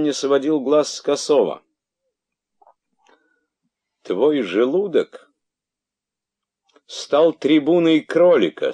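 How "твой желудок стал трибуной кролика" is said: медленная, повышенная, грозная речь; чекань медленно, с угрозой; грозно. A narrator's reading. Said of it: "сказал он грозно". (грозно и размеренно)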